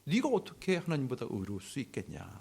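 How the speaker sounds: ordinary delivery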